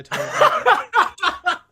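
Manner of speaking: high, loud, slowed laughing squeal. Real, not acted. To her cohost about the nurse, a squealing laugh.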